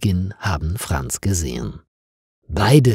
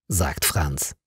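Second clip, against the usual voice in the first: plain reading voice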